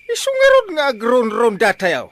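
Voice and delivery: loud, high scolding; angry scolding. Acted. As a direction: give him a furious dressing-down. Uncle scolding nephew.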